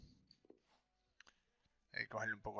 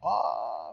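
sharp loud yelp of shock; startled shout; 6 semitones higher, 14 dB louder than usual. Real, not acted. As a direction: cry out as the ball goes wide. Sudden miss in the match. (grito de sorpresa)

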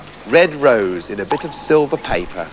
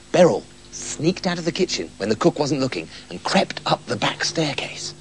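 softer and softer, tiptoeing words; hushed storyteller whisper; sneaky whisper; 4 semitones lower, 8 dB quieter than usual. A narrator's reading. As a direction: narrate in a hushed whisper as she creeps. Sneaking narration.

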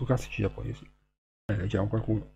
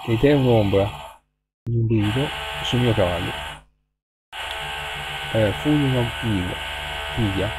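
loud, measured reading of a haiku; poem recital; 6 dB louder than usual. Acted. Reading a winter haiku aloud to viewers over the noise, loud recital. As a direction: project each line like a recital over noise.

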